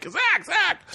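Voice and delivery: high mock holler; calling out a name. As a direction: try to get through over a chainsaw. Retold callers shouting over chainsaw.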